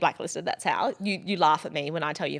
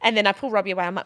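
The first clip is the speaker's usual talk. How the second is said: ordinary talk, nothing marked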